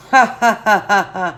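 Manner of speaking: loud bursts of ha ha; real laugh, loud at first. Real, not acted. Laughing at the comment.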